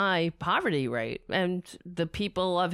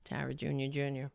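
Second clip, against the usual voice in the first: softer, low doting pet name; 6 semitones lower, 8 dB quieter, faster than usual. Real, not acted. Man presenting his cat to viewers, doting and soft. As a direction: croon the cat's name. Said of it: "Ladies and gentlemen".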